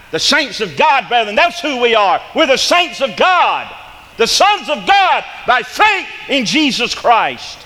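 high, loud, slow preaching shout; full-force preaching. Acted. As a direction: build the congregation up to a ringing shout. Preaching climax on saints of God.

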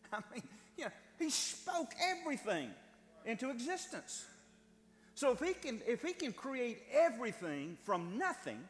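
high, loud, ringing sermon climax; full-force preaching. Acted. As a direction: preach it out with fire.

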